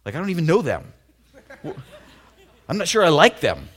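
mock indignant retort; incredulous. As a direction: protest that you don't know these people. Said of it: "I'm like".

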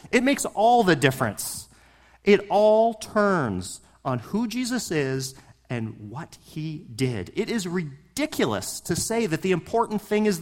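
loud, rising preacher rant. Real, not acted. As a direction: push it hard, getting carried away. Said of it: "I'm on a bit of a rant here".

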